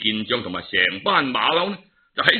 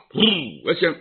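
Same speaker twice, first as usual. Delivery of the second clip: ordinary delivery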